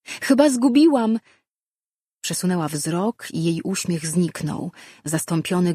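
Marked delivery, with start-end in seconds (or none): 0.1-1.2 high-pitched, raised voice; calling out. A narrator's reading. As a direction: call it out brightly from a distance. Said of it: "zawołała".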